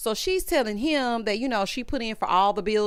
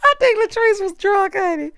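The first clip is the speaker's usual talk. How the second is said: laughing; 11 semitones higher, 6 dB louder than usual. Real, not acted. Laughing comment on Latrice.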